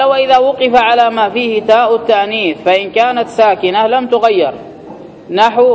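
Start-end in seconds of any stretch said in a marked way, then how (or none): 0.0-4.5 higher, measured textbook recitation; reading set text. Acted. Teacher reading the grammar book to students, formal recitation.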